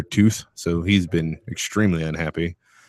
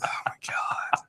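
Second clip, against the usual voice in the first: whispering; 11 dB quieter than usual. Real, not acted. Aside about Mason.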